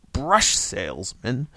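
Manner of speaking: slow, loud, scornful question; drawn-out contempt on the first word; sneering. A narrator's reading. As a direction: sneer it like a drunken husband. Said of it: "Art sneered".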